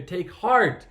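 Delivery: loud, high encouraging call. Retold or imitated. Crowd calling to the blind beggar, retold as a loud call. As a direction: call it out to rouse him. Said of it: "and everybody calls him".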